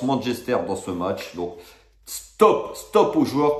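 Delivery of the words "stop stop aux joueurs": sudden high shouted stop; sharp shout. Real, not acted. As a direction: bark out stop to cut it off. Coup de gueule contre les joueurs. (cri sec et aigu)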